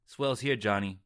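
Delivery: light Brooklyn twang; Brooklyn accent. Acted. Tyler line read in accent.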